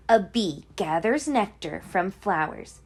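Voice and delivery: plain reading voice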